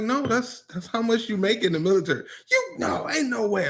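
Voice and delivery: high incredulous mock protest; mock disbelief. Girlfriend refuses to believe military pay, mock disbelief. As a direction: act out her disbelief.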